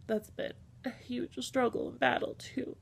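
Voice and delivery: tearing up. Real, not acted. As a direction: struggle to get it out through tears. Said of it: "tearing up the little bit".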